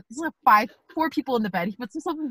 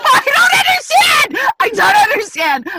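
shouted, much higher, laughing through it; baffled shout; 12 semitones higher, 10 dB louder than usual. Real, not acted. Friend yells in baffled disbelief about the dream. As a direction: yell it in total disbelief.

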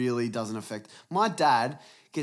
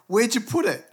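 nagging dad; 8 semitones higher, 5 dB louder than usual. Retold or imitated. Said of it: "he's like".

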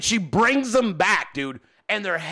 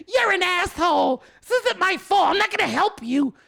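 much higher mock-indignant wife voice; imitating angry wife; 11 semitones higher than usual. Wife refusing to help after being cursed, high indignant mock voice. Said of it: "I'm cursing her. She's like".

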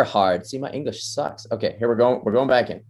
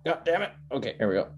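normal talking voice

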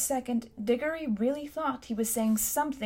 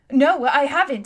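loud, higher-pitched indignant denial; 4 semitones higher, 9 dB louder than usual. A narrator's reading. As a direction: protest hotly at being accused. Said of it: "said Diggory indignantly".

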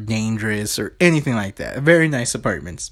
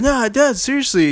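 high, earnest protest; insistent guard; 12 semitones higher, 3 dB louder than usual. Retold or imitated. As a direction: plead to be believed, pitched up. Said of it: "he's like".

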